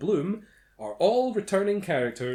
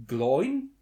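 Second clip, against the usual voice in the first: ordinary talk, nothing marked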